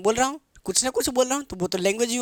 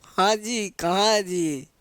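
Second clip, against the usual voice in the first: lightly mimicked coy girl on a call; a hint of a girl cooing into the phone; much slower than usual. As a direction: mimic a girl sweet-talking her boyfriend, drawn out. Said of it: "लड़कियाँ phone ले ली".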